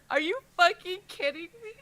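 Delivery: high, loud gush; excited disbelief. Real, not acted. Fan raving to co-host about the scene, high and loud. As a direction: squeal at the page.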